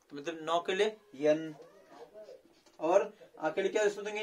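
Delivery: ordinary talk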